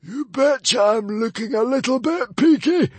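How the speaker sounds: high, loud shriek; shrieking librarian. Acted. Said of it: "shrieked the hairy, scary librarian".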